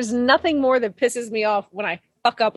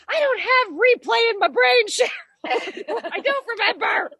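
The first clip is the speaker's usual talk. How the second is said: exasperated retort; 10 semitones higher, slower than usual. Retold or imitated. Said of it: "I was like".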